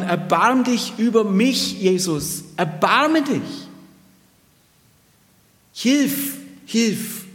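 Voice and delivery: a supplicant calling out to Jesus; desperate plea. Acted. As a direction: beg for help, drawn out and straining upward. Pleading man voiced in Bible story.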